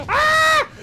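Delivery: high, loud yelp; sudden high-pitched shriek; startled scream. Acted. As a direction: scream out as if just hit. Hit during the game.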